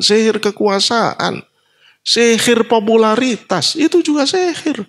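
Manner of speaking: high, insistent sermon delivery; emphatic preaching. Real, not acted. Preacher warning listeners about worldly sorcery, emphatic preaching. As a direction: build the list, climbing very high.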